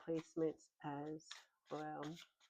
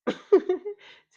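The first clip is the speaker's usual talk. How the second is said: short burst of laughter; 16 dB louder than usual. Real, not acted. Laughs at stumping tarot outcome.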